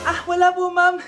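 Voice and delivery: ordinary talk, nothing marked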